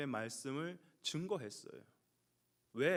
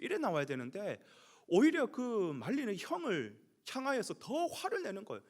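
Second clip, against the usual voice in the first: ordinary talk, nothing marked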